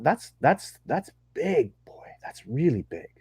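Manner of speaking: hushed, breathy whisper of wonder; whispered awe; awed whisper. Real, not acted. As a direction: whisper it like it's sacred. Awed at Sasquatch encounter.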